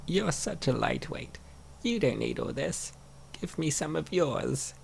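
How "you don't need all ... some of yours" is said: normal talking voice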